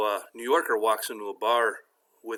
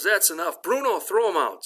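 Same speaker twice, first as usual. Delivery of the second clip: higher, raised-voice order; hammed-up exasperated command; fed-up bartender; 9 semitones higher, 4 dB louder than usual. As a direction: call the bouncer over, had enough. Fed-up bartender calls the bouncer.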